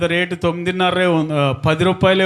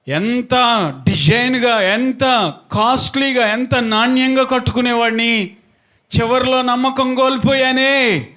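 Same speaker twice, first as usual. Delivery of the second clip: acted lament in a raised preaching voice; retold regret; 8 semitones higher than usual. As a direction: wail the regret at preaching pitch, mildly. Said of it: "అని బాధపడుతున్నాడు".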